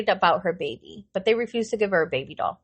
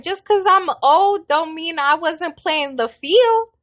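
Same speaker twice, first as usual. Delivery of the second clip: put-on sassy old lady; 9 semitones higher, 4 dB louder, slower than usual. Old patient boasting, voiced by teller.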